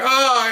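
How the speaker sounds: high-pitched retold cry of pain; mock scream. Officer with hurt knee rolling suspect over, screaming. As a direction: imitate yourself still yelping in pain. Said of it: "him and I are both parallel screamers".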